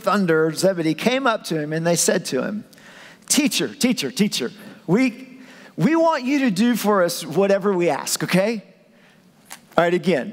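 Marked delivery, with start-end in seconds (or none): 3.3-8.6 put-on higher, childish pleading; whiny disciples. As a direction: beg like kids asking for anything.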